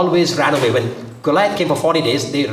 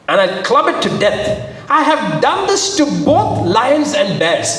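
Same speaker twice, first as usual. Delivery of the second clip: high, emphatic declaration; bold boast; 7 semitones higher, slower than usual. Acted. David telling Saul what he has done, boldly. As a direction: build to a drawn-out, proud claim.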